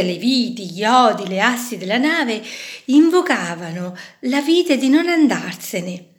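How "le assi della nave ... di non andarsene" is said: usual speaking voice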